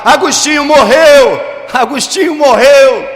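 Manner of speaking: loud, high cry flung back while fleeing; acted shout. Agostinho fleeing his former lover, acted shout. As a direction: shout it out high, as if running away.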